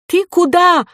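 very high, loud, outraged call; shouting after. A narrator's reading. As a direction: yell after someone running off. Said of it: "крикнула вслед ей директриса". (пронзительный окрик вслед)

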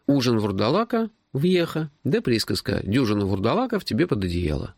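high-pitched, worked-up protest; baffled young hero. A narrator's reading. Hero protests to his friend that werewolves don't exist, baffled. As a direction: argue in bewilderment, pitch well up. Narrator voices baffled Max arguing.

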